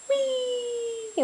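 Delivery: put-on a delighted high "whee" for the baby; high playful whee. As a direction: squeal it way up high.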